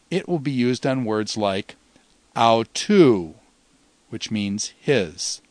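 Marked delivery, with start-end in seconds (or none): 2.3-3.3 autou with weight on TOU, pitch falling; circumflex accent. Shown as a demonstration. Teacher showing the circumflex, autou stressed on a falling TOU. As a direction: stress the last syllable of "autou", letting it fall. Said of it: "went up and down".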